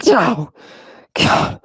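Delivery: ordinary talk, nothing marked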